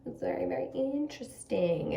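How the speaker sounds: whispered aside. Real, not acted. Aside while turning to the cards.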